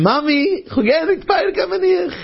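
put-on high little child pleading to mommy; child's voice. Small angel pleads with mommy in a high child voice. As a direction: squeak it up like a small kid.

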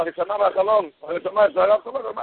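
ordinary delivery

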